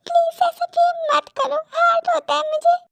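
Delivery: high, wounded plea; hurt, pleading woman. Acted. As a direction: beg him to stop, pitched high. She says he hurts her.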